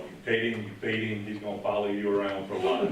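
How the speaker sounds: ordinary talk, nothing marked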